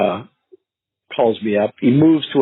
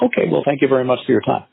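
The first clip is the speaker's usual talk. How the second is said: quick polite sign-off; 3 semitones higher, faster than usual. Shown as a demonstration.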